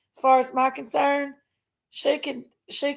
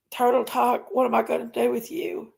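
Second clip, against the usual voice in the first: ordinary talk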